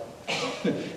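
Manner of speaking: short airy laugh at his own joke; a quick unvoiced chuckle; breathy laugh. Real, not acted. Laughing at his own joke to the audience.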